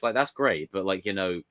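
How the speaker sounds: ordinary talk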